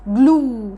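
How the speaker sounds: "جلو" as a drawn-out "gloo"; double-O sound. Shown as a demonstration. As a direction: stretch the end of "جلو" into a long "oo". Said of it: "كانها اتنين او". (مد الواو في "جلو")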